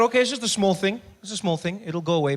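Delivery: loud, high and breezy; loud excited thought. Retold or imitated. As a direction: shrug it off loudly. Inner thought, shouted building.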